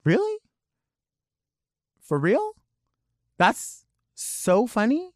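high, rising questioning pitch; can't fake amusement, high and doubting; skeptical disbelief. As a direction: drag it out as an incredulous question. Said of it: "hard for me to laugh through it".

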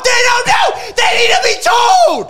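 loud, high-pitched shouting over the others; shouted protest. Real, not acted. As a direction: yell it out to cut in.

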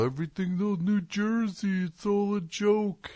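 a mild mock of a snooty New Yorker. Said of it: "You get these New York people".

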